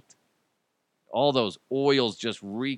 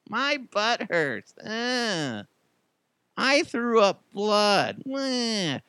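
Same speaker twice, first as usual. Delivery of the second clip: high, slow whining mock complaint; a mock-pitiful husband moaning in a high voice; whiny husband; 8 semitones higher, much slower than usual.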